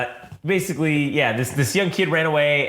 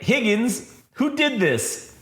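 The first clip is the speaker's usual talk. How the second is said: higher and slow, horrified cry of a name; stunned disbelief; 8 semitones higher, much slower than usual. Retold or imitated. Detective finds his beaten partner, horrified and slow. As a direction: gasp it out, aghast at what he sees.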